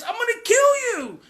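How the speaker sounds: high, loud mock threat; retold threat. Teenager threatening a parent, high mock yell. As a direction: imitate a teen yelling a threat. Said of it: "Or it's".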